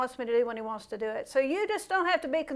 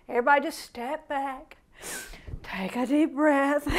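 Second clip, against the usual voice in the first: mocking repetition. Preacher mimicking a friend's repeated calming advice, laughing. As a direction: parody a calm-down speech while laughing. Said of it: "He says it over and over".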